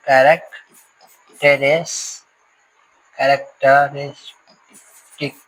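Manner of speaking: Characteristic drawn out slowly in syllables. Shown as a demonstration. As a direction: stretch characteristic out slowly, piece by piece. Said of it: "example हम लोग देख लेते हैं".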